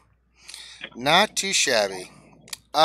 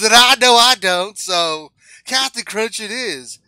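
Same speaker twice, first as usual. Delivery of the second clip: loud, booming sponsor plug; ad read; 5 semitones higher, 8 dB louder than usual. Pretend. Host reads a joke sponsor spot to the audience as a loud announcer. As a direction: sell the cereal like a TV announcer.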